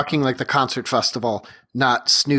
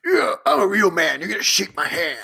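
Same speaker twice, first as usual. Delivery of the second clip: higher, hammed-up tough guy boast; mock macho; 6 semitones higher than usual. Host mocking handshake power moves as a macho guy, lightly hammed up. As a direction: lightly play a guy flexing with his handshake.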